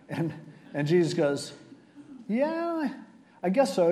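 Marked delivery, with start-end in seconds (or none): 2.3-3.9 dubious agreement. Acted. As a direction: play along doubtfully in a high voice. Acted wry reply of Jesus.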